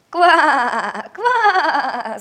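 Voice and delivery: loud laughing croak; laughing frog croak. Acted. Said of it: "засмеялась лягушка".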